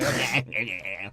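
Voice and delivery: repeated cackling goblin laugh; hammed-up Italian goblin cackle; Pesci's goblin laugh. Burglar gloating over the toy store, goblin laugh. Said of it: "Pesci's Italian goblin laugh over and over".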